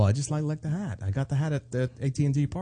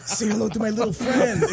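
Scarface quote; 8 semitones higher than usual. Retold or imitated.

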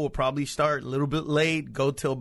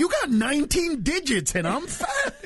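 higher, laughing mock outrage; incredulous retort; 9 semitones higher than usual. Comic throwing the jab back at his diabetic friend, laughing and higher. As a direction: laugh through the comeback. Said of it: "It was like".

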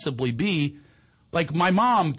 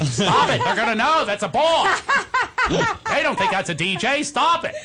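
higher, louder exasperated mom; mom's voice; 11 semitones higher, 4 dB louder than usual. Retold or imitated. Son imitating mom scolding through the car radio, higher and exasperated. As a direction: beg him to quit like a worried mother. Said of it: "it's very accurate".

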